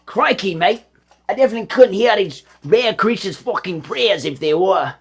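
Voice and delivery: hammed-up Aussie, crocodile hunter style; doing the Australian accent on request. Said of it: "but with the Australian accent".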